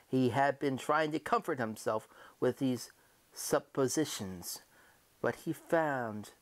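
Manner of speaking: ordinary delivery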